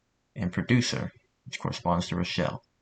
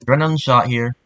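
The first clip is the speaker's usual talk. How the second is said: louder, higher in-game callout; voice line recording; 4 semitones higher, 9 dB louder, faster than usual. Shown as a demonstration. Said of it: "my diction wasn't the best there".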